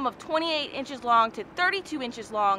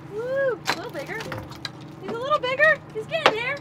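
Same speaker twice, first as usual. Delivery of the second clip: excited; 9 semitones higher than usual. Real, not acted. Reeling in a bigger snook.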